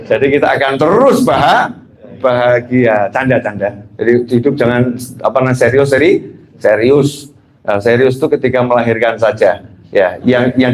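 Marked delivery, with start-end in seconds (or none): none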